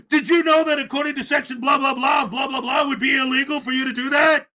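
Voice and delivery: hammed-up legal jargon, dismissive; mock lawyer grilling. Vlogger mimicking the auditor citing the law, loud and mocking.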